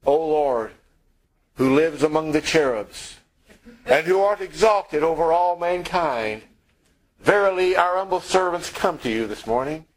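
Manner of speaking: overly formal, lofty prayer voice; pompous prayer. Retold or imitated. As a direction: put on airs like a stuffy liturgy. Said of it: "Notice he didn't say".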